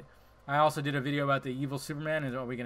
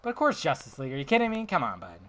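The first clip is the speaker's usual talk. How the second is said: usual speaking voice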